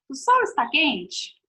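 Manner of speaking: "O sol está quente?" asked slowly as a question; question tune. Shown as a demonstration. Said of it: "Tô fazendo uma pergunta pra vocês". (entonação de pergunta)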